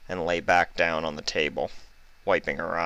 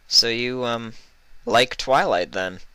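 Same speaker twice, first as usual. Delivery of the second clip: higher hesitant voice; shy question; 6 semitones higher, 3 dB louder than usual. Acted. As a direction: ask it awkwardly. Vinyl asking shyly.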